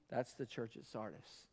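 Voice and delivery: near whisper. Real, not acted. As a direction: let it die away to nothing. Hushed repeat after shouting.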